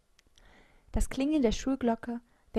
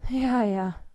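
louder, drawn-out grudging assent; dismissive murmur; 2 semitones higher, 6 dB louder than usual. A narrator's reading. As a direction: brush it off reluctantly. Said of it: "murmelte ich". (genervt abwinkend)

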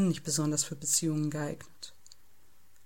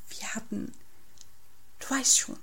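hushed, halting confidence; lightly whispered, secretive hint; whispering; 4 semitones higher, 4 dB quieter than usual. A narrator's reading. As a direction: start very softly, hesitate, then get it out. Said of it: "flüstert Felix".

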